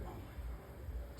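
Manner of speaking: breathy, slow exasperated whisper. Retold or imitated. Parent worn out by chattering kids, whispering in exasperation. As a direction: sigh it out under your breath.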